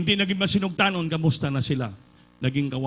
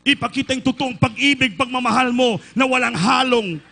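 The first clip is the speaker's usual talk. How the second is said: loud, high-pitched preaching climax; full-force preaching; 8 semitones higher, 6 dB louder than usual. Real, not acted. Preacher exhorting the congregation at the climax, loud. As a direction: urge the church to submit, loud.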